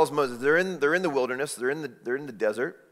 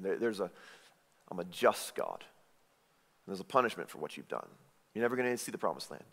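pronouncing judgment; 5 semitones lower, 7 dB quieter, faster than usual. Retold or imitated. God pronouncing Moses' punishment.

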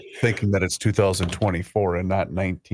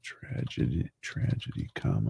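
muttering to self; 3 semitones lower, 9 dB quieter than usual. Real, not acted. Typing while muttering dictated words.

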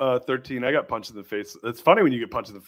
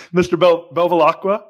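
normal talking voice

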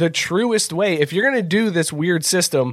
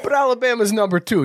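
wise guy; 4 semitones higher than usual. Acted. Mobster bit about Alabama ranking.

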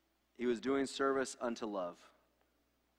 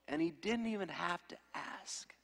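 whisper; 5 semitones higher, 6 dB quieter than usual. Real, not acted. Hushed aside about the child.

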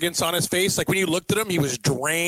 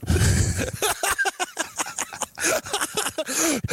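high burst of laughter; 12 semitones higher than usual. Real, not acted. Laughing at the review.

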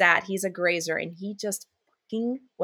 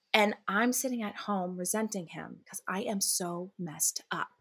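confessional whisper; 6 dB quieter than usual. Real, not acted. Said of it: "I'm messed".

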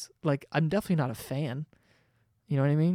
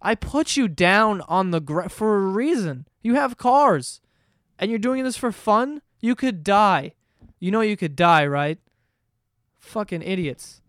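exasperated scolding shout, higher and louder; God scolding skydivers; 4 semitones higher, 5 dB louder than usual. Retold or imitated. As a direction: yell at them like an angry father. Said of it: "he'd be like".

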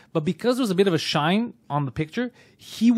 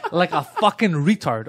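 ordinary delivery

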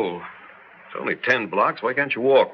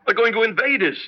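climbing higher and faster into panic; frantic alarm; 11 semitones higher, faster than usual. Acted. Husband warning his wife of invasion, frantic and rising. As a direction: spiral up into frantic fear.

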